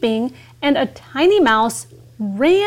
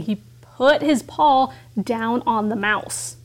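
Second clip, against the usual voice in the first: ordinary delivery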